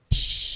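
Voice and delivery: long, hissing shush. Shown as a demonstration. Teacher shows students how to shush someone, shushing. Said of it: "Have you ever shushed someone?".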